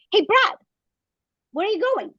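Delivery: calling to husband going downstairs. Retold or imitated.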